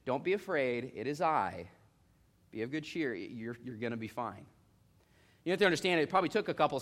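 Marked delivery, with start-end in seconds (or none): 0.0-4.5 lower, softer comforting words; reassuring. Retold or imitated. Jesus immediately calming the disciples, low and gentle. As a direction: soothe them right away. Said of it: "he immediately said".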